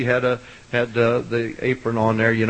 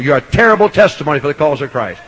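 normal talking voice

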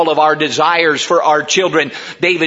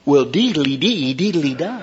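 mock sing-song; 3 dB quieter than usual. Mocking the worldly advice.

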